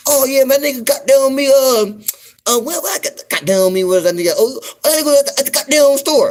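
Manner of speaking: mock Southern reply, high and loud; Southern dude. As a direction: play the other guy answering, hammed up.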